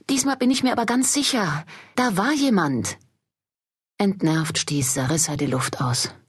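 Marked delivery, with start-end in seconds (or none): 0.1-3.0 much higher, eager insistence; frightened maid pleading to be believed; insistent and urgent. Acted. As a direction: convince him she really heard someone. Lou insists she heard someone.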